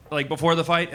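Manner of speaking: ordinary talk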